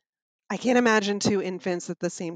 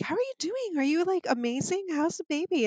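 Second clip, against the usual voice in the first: high, bright mock cheerful voice; sing-song mock cheer, much higher; chirpy visitor; 9 semitones higher than usual. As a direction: imitate people cooing over the baby. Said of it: "people would be like".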